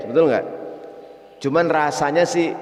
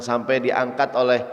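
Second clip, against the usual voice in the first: usual speaking voice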